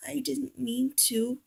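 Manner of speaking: soft, slow, tearful whisper. A narrator's reading. Oliver whispers his apology, crying, soft and slow. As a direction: whisper it through tears. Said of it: "he whispered".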